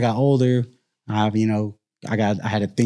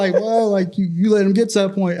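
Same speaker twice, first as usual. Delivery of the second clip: mock blaming; 8 semitones higher than usual. Mocking coworker's blame.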